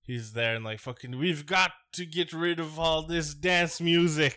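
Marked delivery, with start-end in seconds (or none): 1.2-4.4 slow, higher, put-on Russian-ish growl; hammed-up Russian strongman line; mock Putin voice. Podcaster mocks Putin, slow hammed-up Russian accent.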